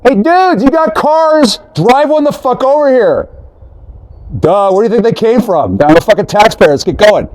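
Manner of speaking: very high, cartoonish bossy voice; yelling orders in a squeaky put-on voice; mock high-voiced demand. Mocking what he would tell the soldiers, high squeaky voice. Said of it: "and then, like".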